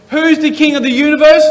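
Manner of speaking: loud, lifted song line; big song line. Shown as a demonstration. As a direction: belt it out with a big arc. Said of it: "make a nice big arc".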